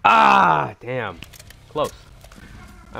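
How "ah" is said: high, loud "ah" of frustration; pained yell. Real, not acted. Gamer reacting to a close miss, frustrated yell. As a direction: cry out at a near miss.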